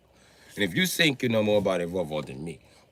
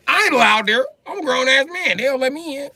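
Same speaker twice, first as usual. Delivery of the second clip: screams it at the top of his voice, close to tears; full-throated outraged yell with a crack of crying; real angry yelling; 9 dB louder than usual. Real, not acted. Ranting to viewers about being turned away, yelling.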